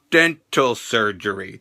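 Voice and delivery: dropped low and slow; mock hesitant delivery; slow deadpan. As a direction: drag out the words deadpan. Mimics McMahon's pause.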